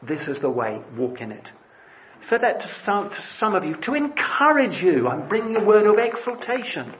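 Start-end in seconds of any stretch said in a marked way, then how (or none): 0.0-1.5 low, soft voice from behind; the Lord's hushed direction; voice behind you. Acted. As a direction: murmur it like a quiet guide over the shoulder. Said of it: "you'll hear that voice behind you saying".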